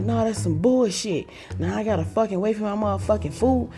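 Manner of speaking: ranting stepdad. Retold or imitated. Hungry stepdad ranting about wings.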